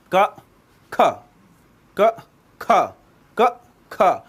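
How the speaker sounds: क said short with barely any breath, then ख with a strong puff of air; unaspirated and aspirated demo. Shown as a demonstration. Teacher showing students the breath difference between क and ख, slow and clear. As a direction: alternate क and ख slowly so the extra breath in ख is clearly heard. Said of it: "आप का बोलिए और खा बोलिए".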